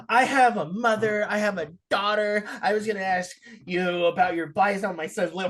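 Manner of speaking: mock someone's overblown personal plea; mimicked indignant supervisor. Mimicking supervisor's sudden indignant rant.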